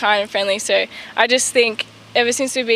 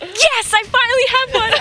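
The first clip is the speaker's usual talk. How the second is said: high, thrilled yell of joy; excited scream; 12 semitones higher than usual. Pretend. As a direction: shriek it like you just hit a hole-in-one. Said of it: "I'd probably jump and scream".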